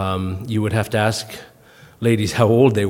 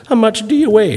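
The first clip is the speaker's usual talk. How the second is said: high, slightly louder mock-polite ask; high sing-song question; 11 semitones higher, 4 dB louder than usual. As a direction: pitch the question up sweetly. Asking the lady her weight.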